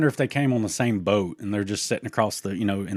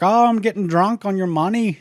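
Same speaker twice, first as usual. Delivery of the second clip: high, loud mocking taunt; 12 semitones higher, 9 dB louder than usual. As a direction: jeer at the rival family. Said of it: "they'd be like".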